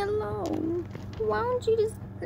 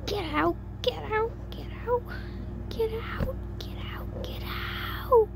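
high, strained cries without real volume; half-whispered, high-pitched mock screaming; acted anger; 13 semitones higher than usual. A narrator's reading. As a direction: repeat it frantically in a high, thin voice. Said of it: "turned to anger".

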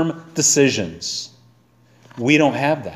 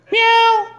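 very high imitated meow; high-pitched mock mew; cat meow. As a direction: mimic a cat's meow. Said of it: "My cat sits there and goes".

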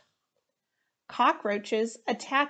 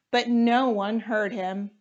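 plain reading voice

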